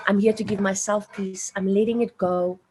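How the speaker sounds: normal talking voice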